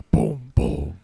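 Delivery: deep, punchy booming sound effect; mimicked low movie boom; imitated explosion boom. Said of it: "like, a sound effect".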